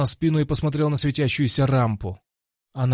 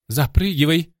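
loud, high call to action; urgent shout; 5 semitones higher, 6 dB louder than usual. A narrator's reading. Максвелл directs the fighter onto the demon's back, urgent shout. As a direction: shout the order with force. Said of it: "крикнул Максвелл".

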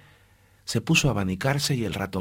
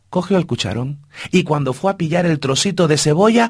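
ordinary delivery, nothing marked

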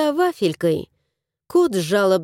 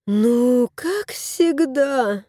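mild groan; 2 semitones higher, much slower than usual. Acted. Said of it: "простонала она".

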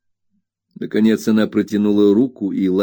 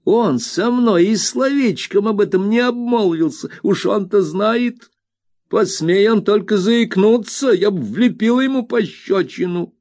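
an indignant servant, voice pitched up; flustered old nurse; 12 semitones higher, 3 dB louder than usual. A narrator's reading. Peggotty voiced high.

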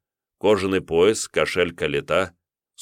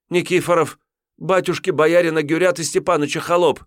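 высоко, сбивчиво; оправдываться в испуге; испуганно; 12 semitones higher, 4 dB louder than usual. Acted. Отрок называет хозяина. (парень испуганно отвечает тиуну)

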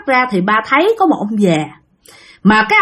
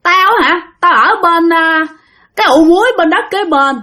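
put-on higher, haughty old man; old ghost's voice; 7 semitones higher, 3 dB louder than usual. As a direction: boast where he lives. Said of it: "Cái ông mới nói rằng".

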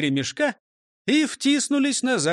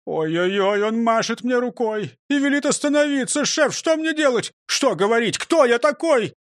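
rising, high-pitched fluster; panicking; 7 semitones higher, 4 dB louder than usual. Acted. Driver begs the chief for help, mounting panic. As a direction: build from worry to loud, high panic.

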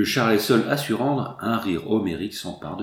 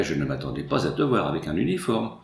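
ordinary delivery, nothing marked

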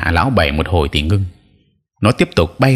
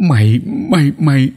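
higher, stammering in disbelief; stammering in shock; 7 semitones higher than usual. A narrator's reading. Old sorcerer stammering at his exposed rival, stunned and higher. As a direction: choke on the words, stunned. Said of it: "Hắn ta ú ớ".

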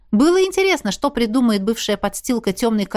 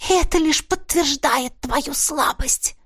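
possessed whisper; 4 semitones higher, slower than usual. A narrator's reading. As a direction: speak slowly through borrowed lips. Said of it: "прошептал кто-то моими губами".

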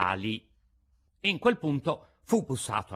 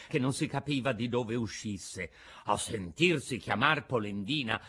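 usual speaking voice

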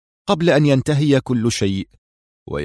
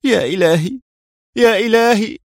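screamed exclamation; 11 semitones higher than usual. A narrator's reading. Woman alone in darkness after the crash, screaming out. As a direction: cry out to God, pitched high. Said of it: "فصرخت".

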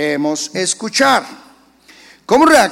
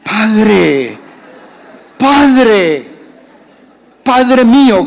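loud, higher fervent cry; grateful cry; 4 semitones higher, 9 dB louder, much slower than usual. Retold or imitated. Believer thanking God for the farm, loud and fervent. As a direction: call out to the Father in gratitude.

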